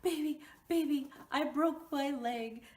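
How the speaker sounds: high, loud, desperate begging on the phone; panicked plea. Retold or imitated. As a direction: beg your boyfriend for help in panic. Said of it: "And I'm like".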